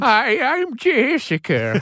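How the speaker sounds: put-on old lady voice. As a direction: play a sweet old grandma introducing herself. Imagining meeting an eighty-year-old Jessica.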